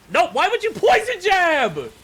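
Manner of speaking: high, loud outburst of disbelief; sudden indignant scream; shocked yell. Real, not acted. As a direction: shriek in protest at the move. Shocked by opponent's move.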